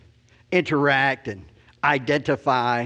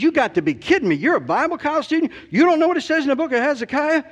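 much higher, incredulous and raised for the crowd; retold teasing chaplain, raised and incredulous; loud public taunt; 11 semitones higher than usual. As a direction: call it out so everyone standing around hears. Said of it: "He didn't do this quietly".

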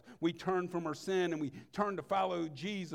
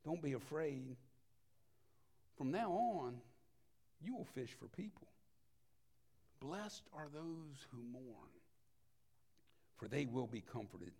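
hushed comfort; 3 semitones lower, 7 dB quieter than usual. Acted. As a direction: hush it down to a tender whisper. Jesus comforts, sermon hushed.

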